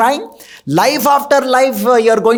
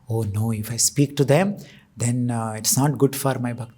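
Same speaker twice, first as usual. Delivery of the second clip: mock new devotee; 10 semitones lower, 9 dB quieter than usual. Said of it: "And they say".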